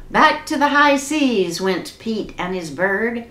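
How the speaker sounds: usual speaking voice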